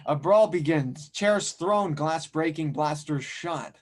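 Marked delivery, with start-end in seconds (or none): none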